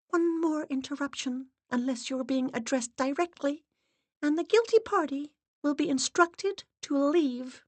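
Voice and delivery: high-pitched headmistress warning; stern séance assistant. A narrator's reading. Assistant warning interrupters, stern headmistress tone. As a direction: issue a strict, measured threat.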